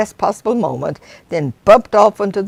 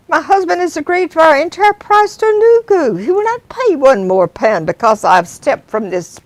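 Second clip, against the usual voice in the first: high, loud indignant refusal; indignant Janice; 8 semitones higher, 4 dB louder than usual. Acted. Passenger refusing the driver's extra pound, loud and indignant. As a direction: refuse flatly, affronted.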